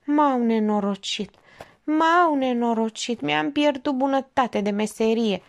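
mock weeping. A narrator's reading. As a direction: lament it slowly, with mild acted tears. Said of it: "Cerșetorul plângea de-a binelea". (plânset jucat, tânguitor)

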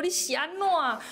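high, sharp retold father's challenge; 父亲高声质问; father's sharp demand. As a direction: snap the question up high like an alarmed dad. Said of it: "我爸爸就站起来说".

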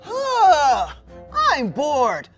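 usual speaking voice

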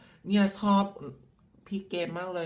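high gushing fan squeal. Retold or imitated. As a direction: play the adoring viewer gushing over her. Reading out a fan's message.

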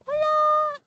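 very high, drawn-out mock greeting; mock squeaky hello. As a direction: squeak a surprised hello at the item. Mock greeting to found item.

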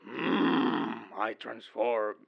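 lightly acted power-up with drawn-out hum; mock transformation after a coffee; transforming meme guard. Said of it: "starts drinking his coffee and goes like".

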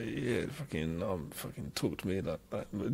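a lightly imitated stoned mutter; muttered under breath. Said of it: "mutters himself under his breath".

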